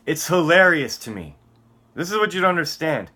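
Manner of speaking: usual speaking voice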